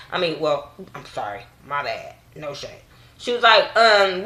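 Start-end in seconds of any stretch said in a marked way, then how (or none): 1.0-2.8 muttered mock apology. Real, not acted.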